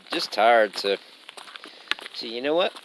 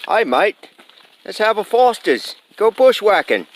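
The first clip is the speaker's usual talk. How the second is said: a hammed-up Australian accent, higher and louder; bad Australian accent; 9 semitones higher, 8 dB louder than usual. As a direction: play a tourist's bad Australian accent, pitched up and loud. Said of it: "Maybe I should speak Australian".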